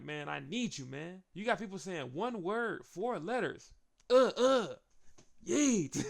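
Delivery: mock pep talk; hyped imitation. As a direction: pump him up, then shout yeet. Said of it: "He's like".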